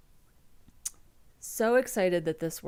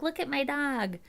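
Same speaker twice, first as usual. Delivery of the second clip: mocking dog owner; 6 semitones higher than usual. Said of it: "one of those people that's like".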